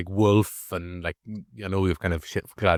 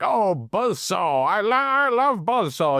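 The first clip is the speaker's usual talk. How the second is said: put-on high, slow little old granny voice; wee granny; 10 semitones higher, slower than usual. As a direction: squeak it out like a delighted old granny. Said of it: "There's this wee granny".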